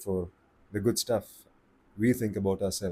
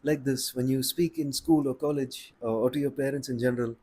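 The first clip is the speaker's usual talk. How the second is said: put-on higher-pitched, polite good-kid voice; good boy voice; 4 semitones higher than usual. Shown as a demonstration. Voice coach showing the timid everyday voice, good-boy voice. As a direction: pitch it up like a well-behaved student. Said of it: "usual good boy or good girl voice".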